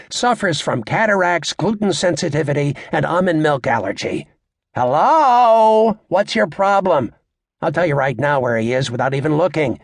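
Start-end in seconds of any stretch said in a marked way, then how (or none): 4.7-7.1 mock exasperation. Comic mocks lost-pet notices.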